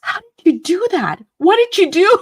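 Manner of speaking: high, loud astonished questions; amazed disbelief. Retold or imitated. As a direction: retell her stunned look at the work. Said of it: "looking all over the place and she goes".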